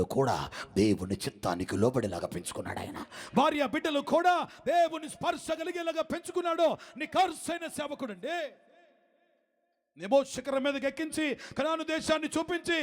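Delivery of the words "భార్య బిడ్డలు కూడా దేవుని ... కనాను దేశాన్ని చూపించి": high, driving sermon cadence; fiery preaching. Acted. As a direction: preach it hard to the congregation. Preaching at full force.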